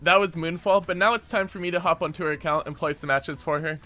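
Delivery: ordinary talk, nothing marked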